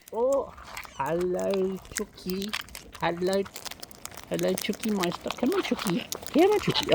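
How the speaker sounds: very high sing-song coaxing to a bird; high coaxing call. Real, not acted. Greeting a bird named Chucky, high coaxing.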